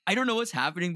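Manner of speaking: ordinary talk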